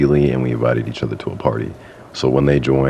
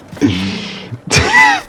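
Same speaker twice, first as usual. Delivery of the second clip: genuinely amused burst of laughter; laughing; 3 dB louder than usual. Real, not acted. Vlogger amused at admitting being catfished, real laughing. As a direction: break up laughing, high and breathless. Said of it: "I'm laughing because it's".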